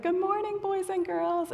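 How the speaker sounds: high, drawn-out singsong hello; children's greeting. Acted. Ministry leader opening time with two to four-year-olds, high singsong. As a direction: greet little kids in a high voice. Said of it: "I always start with".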